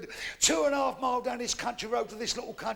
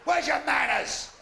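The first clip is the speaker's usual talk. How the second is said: high, shouted objection from a woman; indignant protest; 7 semitones higher, 6 dB louder than usual. Acted. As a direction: shout it high, halting his advances. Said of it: "She went".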